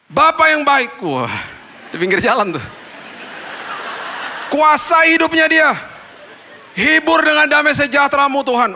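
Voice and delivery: prayer at full force; fervent loud prayer. Retold or imitated. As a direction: cry out to God over the crying courier. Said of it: "Saya doa lah".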